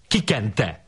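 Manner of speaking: acted indignant question, pitch leaping up; mildly outraged challenge; flushed accusation. A narrator's reading. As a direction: accuse with rising pitch, not volume. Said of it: "szólt elvörösödve Andrási".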